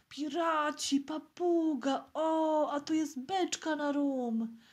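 put-on high, loud child's exclamations, drawn out; full-voiced excitement. A narrator's reading. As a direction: call out each find like a thrilled child. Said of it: "zawołał Piotruś pełnym głosem". (podekscytowane dziecięce okrzyki)

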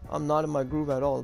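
usual speaking voice

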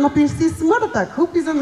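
usual speaking voice

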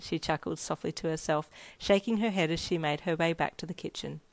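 usual speaking voice

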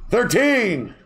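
high, loud whooping call; shouted number call. Pretend. Willing a winning number onto the ticket, high shout.

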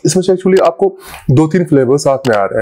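speaking with food in mouth; words between bites; talking while chewing. Real, not acted. Seen 0: Bit the dessert just before; lips pressed between words, spoon in hand.

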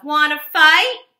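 loud, high dare; grouchy ladybug. Acted. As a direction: taunt loudly. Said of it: "said the grouchy ladybug".

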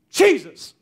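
high, loud, explosive exclamation; sudden shout. Retold or imitated. Speaker suddenly cries out to a deaf woman, sudden shout. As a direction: burst it out without warning. Sudden cry that made her jump.

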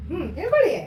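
high, singsong coaxing of a little one. Real, not acted. Mother urging her child to sip juice through a straw, coaxing.

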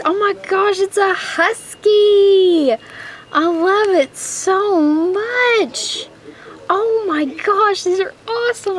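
high, slow gushing delight; thrilled squeal. Real, not acted. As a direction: squeal over a gift you love. Opening husky gift.

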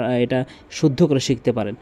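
normal talking voice

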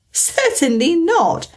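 high, offended denial; indignant. Acted. Alice rejects the Mock Turtle's question, indignant. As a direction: bristle at the suggestion. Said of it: "said Alice indignantly".

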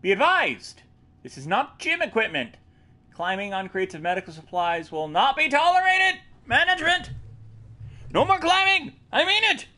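high, stern management voice; stern announcement. Acted. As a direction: scold like angry management. Voices stern memo ending.

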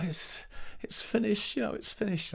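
a retold teenage sob-whisper at the show's end; tearful. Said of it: "crying my eyes out".

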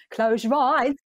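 high, slow sung Cockney imitation; mock Cockney accent. Mimicking the audition back, mock Cockney accent. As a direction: sing it as a teasing Cockney send-up. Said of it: "You sounded Cockney".

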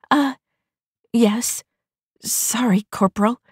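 high, nervous stammered apology; stammering. A narrator's reading. Private apologising to her corporal, nervous stammer. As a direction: fumble the apology, flustered. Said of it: "Private Vi stammered".

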